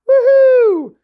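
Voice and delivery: high, loud whoop of joy; excited cheer. Real, not acted. Cheering for a super chat.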